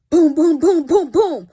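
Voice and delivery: loud booming knock sounds; imitated door banging. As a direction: pound out the knocks with your voice.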